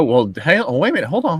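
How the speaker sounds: normal talking voice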